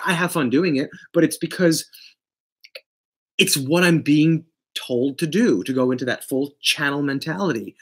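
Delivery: ordinary talk, nothing marked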